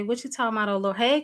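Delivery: normal talking voice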